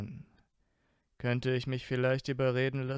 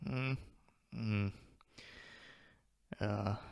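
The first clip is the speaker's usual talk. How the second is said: soft, drowsy murmur; tired Draco answering, softly mumbled; sleepy mumble; 2 semitones lower, 8 dB quieter, slower than usual. Acted. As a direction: mumble as if half asleep. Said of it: "nuschelte der Blonde".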